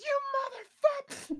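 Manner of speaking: an angel howling in rage as he is banished; furious scream. Retold or imitated. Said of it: "he goes".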